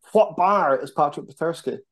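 mock OSW catchphrase. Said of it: "just going full O S W".

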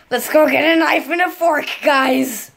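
a hint of a monster. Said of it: "I sound like I was, like, a monster".

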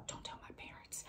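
conspiratorial whisper, all breath. Real, not acted. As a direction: hush it like a secret confession. Joking aside about partying.

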